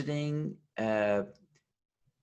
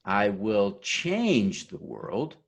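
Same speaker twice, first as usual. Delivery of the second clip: slow, "change" raised and stretched out; stress on CHANGE; 3 semitones lower, much slower than usual. Shown as a demonstration. Speaker leading the oath's next round, stress on "change". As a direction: make "change" the big word, held long. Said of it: "each time we'll emphasize a different word".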